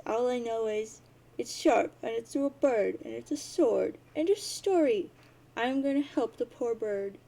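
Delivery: loud, hammed-up childish insistence; insistent protest. Jade argues over impaled bird.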